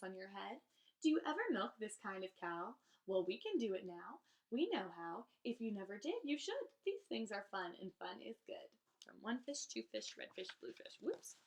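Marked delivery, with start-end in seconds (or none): none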